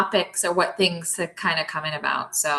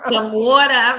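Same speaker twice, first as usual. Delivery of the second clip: New York water, said wawta; broad New York accent on water; 5 semitones higher, 9 dB louder than usual. Shown as a demonstration. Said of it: "my New York accent".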